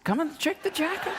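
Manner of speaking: high excited call. Pretend. Man in singlet calling friends to see jacket, high. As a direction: show off the new jacket. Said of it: "he calls out".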